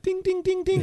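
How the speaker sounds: high, quick piano-note dings; ding like struck piano keys; imitated piano dings. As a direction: plink out high repeated notes. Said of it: "walked back over to the piano and went".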